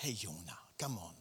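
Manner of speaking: low, soft call; gentle coaxing. Acted. God softly coaxing the angry Jona. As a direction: coax him kindly, low and quiet. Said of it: "macht wie sein Fenster auf und sagt".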